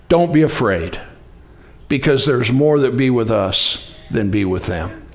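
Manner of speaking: low, soft, reassuring; calm prophet. Acted. Elisha calming his frightened servant, low and soft. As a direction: settle him down gently and low.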